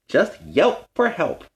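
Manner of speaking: slow, ringing catchphrase. Acted. Ryder calling out the team catchphrase. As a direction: land it like a hero's motto. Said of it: "that's their catchphrase".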